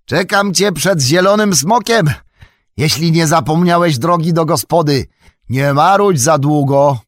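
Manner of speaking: high, loud teasing holler; calling back. A narrator's reading. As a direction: shout it to a friend falling behind. Sam calls back to Robin.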